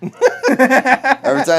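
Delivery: loud, high, slow laughing complaint. Real, not acted.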